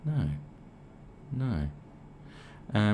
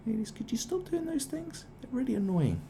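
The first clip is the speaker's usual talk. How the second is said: breathy, much higher sarcastic whisper; mock whisper; 4 dB quieter than usual. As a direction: hiss it like a sneaky plea. Sarcastic aside to women.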